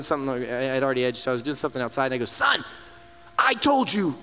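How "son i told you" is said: sharp raised scold, higher; harsh scolding. Retold or imitated. Father scolding son for crossing treated grass. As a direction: snap at the boy like a stern father. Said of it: "like my dad would have done".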